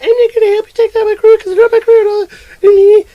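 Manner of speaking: very high, loud cartoon whine; squealing mock refusal. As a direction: squeal the protest in a silly high voice. Retold crook refusing the cops.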